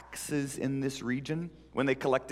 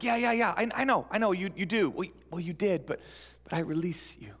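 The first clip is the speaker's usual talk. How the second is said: eager insistence; 6 semitones higher than usual. Acted. Eager interruption.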